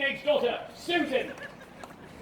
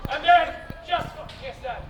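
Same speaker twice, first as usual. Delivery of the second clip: usual speaking voice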